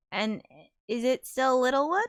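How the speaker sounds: ordinary talk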